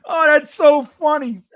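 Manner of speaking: ordinary talk, nothing marked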